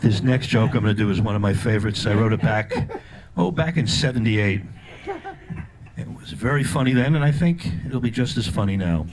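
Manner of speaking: low, smooth showbiz voice; lounge-singer intro. Pretend. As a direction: introduce the joke like a crooner's ballad. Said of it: "I think comedians should do that with jokes like".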